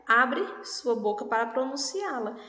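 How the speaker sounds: ordinary delivery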